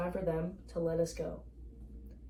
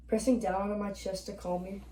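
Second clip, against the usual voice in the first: ordinary delivery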